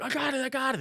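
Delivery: very high mimicked call; imitated eager insistence. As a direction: pipe up like a friend after a wipeout. Friend's cry after crash retold.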